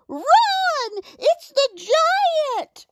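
very high-pitched mock scream; panicked scream. A narrator's reading. Animals flee the approaching giant, screaming. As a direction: squeal the warning high and drawn out. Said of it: "the animals all screamed".

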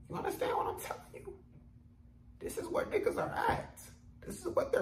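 mock whisper.

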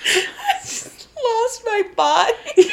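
laughing through it, slow and high. Real, not acted. Friend cracking up at her own forgotten car, high slow laughter. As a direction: crack up mid-line.

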